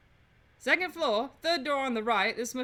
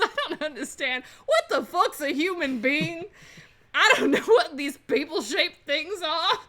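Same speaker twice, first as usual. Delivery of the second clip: loud, confused outburst breaking into laughter; laughing bewilderment; 3 semitones higher, 4 dB louder than usual. Acted. Detective baffled by statues and people-shaped things, laughing outburst. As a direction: plead total confusion while cracking up.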